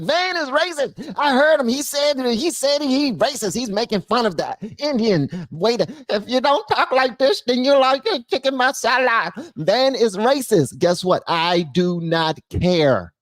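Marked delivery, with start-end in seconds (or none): 0.0-10.8 high, whiny imitation of an offended critic; mock tattletale. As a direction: mimic someone running to tell on you, pitched way up. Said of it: "if you wanna run and be like".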